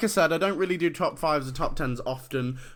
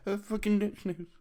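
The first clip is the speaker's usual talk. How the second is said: much softer, higher-pitched reluctant answer; reluctant mumble; 7 semitones higher, 6 dB quieter than usual. Acted. Trade recipient admitting the silly nickname, embarrassed mumble. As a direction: mumble it, hoping not to be heard.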